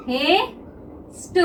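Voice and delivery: Haste stretched very slowly and softly while drawing it; slow drawn-out word. Shown as a demonstration. Teacher to students, stretching haste slowly and softly as she draws it. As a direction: draw out haste slowly and quietly, syllable by syllable. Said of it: "तो देखिए हम haste को किस तरह से बनाते हैं".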